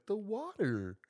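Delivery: put-on high dumb boyfriend answer; clueless boyfriend voice. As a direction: say it like it's obvious. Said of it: "he's like".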